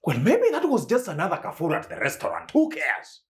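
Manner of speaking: quick, high-pitched brush-off; high, hurried "who cares"; dismissive. Acted. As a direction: wave it away, voice jumping up. Brushes off the ex's visit.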